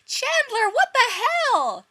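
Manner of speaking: shrieking, very high and loud; screeching Monica. Acted. Monica catching them together, shrieking very high. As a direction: screech it in outrage.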